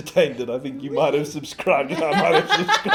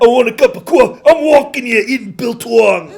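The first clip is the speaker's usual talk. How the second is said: put-on New York accent; 11 semitones higher, 14 dB louder than usual. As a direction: ham up a New York tough guy. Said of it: "That sounded New York".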